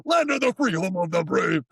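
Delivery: hammed-up high patriotic proclamation; mock patriotic. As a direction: declaim it like a mock anthem. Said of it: "you know, like".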